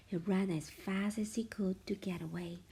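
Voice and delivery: ordinary delivery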